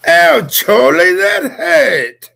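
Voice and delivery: very high, loud, drawn-out English wail; mock English accent. Co-host imitating a hurt English child, high mock wail. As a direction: squeal slowly in an exaggerated English accent.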